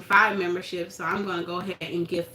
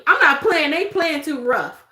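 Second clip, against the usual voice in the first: put-on whiny kid quitting; 5 semitones higher, 5 dB louder than usual. Kid quits the game.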